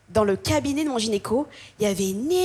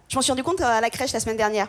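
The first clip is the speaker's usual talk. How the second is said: ordinary talk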